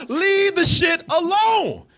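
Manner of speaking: slow, drawn-out high plea; slow exasperated high demand. Real, not acted. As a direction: beg them to stop, stretching it out. Exasperated at remakes.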